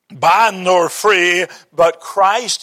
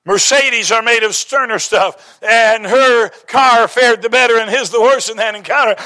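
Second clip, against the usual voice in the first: ordinary talk, nothing marked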